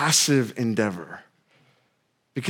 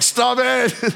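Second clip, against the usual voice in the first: put-on higher, half-laughing panic at the kids; a scared dad yelling stop, pitched way up; panicked shout; 8 semitones higher than usual. Dad on the bridge begging his bouncing daughters, high panicked plea.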